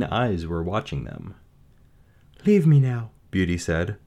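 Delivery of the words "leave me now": put-on brave young woman. Beauty voice.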